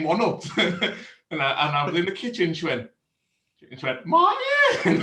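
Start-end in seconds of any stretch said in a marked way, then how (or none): 4.0-5.0 high loud call of Martin; calling out name. Retold or imitated. Wife calling husband from another room, loud high call. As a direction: yell his name across the house.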